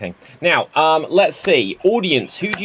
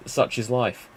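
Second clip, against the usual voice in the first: ordinary talk, nothing marked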